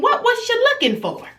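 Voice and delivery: high-pitched incredulous demand; scolding mom, voice shooting up; exasperated questioning. Retold or imitated. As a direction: demand an answer from a snooping child. Acted scolding of snooping daughter.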